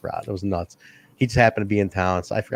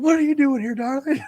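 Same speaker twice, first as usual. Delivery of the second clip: imitating a smitten wrestler; 14 semitones higher than usual. Ref meets his old nurse, high mock crooning. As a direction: coo it like a starstruck lover. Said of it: "He said".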